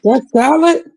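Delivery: very high, rising disbelief; incredulous. Real, not acted. Friend questioning that the dish is salad, incredulous. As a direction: squeak it as a baffled question.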